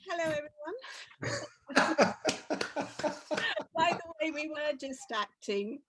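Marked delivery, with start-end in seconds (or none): 1.7-3.7 loud, high belly laugh; real burst of laughter. Real, not acted. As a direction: burst out laughing after staying in role. Laughing after the sketch ends.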